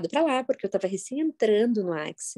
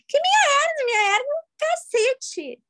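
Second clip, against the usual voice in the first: indignant outburst. Real, not acted. Scoffing at her old hernia complaints, high indignant outburst. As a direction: throw it back in mock outrage.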